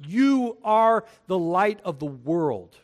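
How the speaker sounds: "You are the light of the world" said slowly and pressed; slow, declared emphasis; emphatic, slowed. Shown as a demonstration. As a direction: lean on each word. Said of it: "And it's emphatic".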